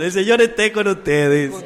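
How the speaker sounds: loud, solemn priestly blessing; liturgical blessing. Acted. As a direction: bless the congregation from the altar.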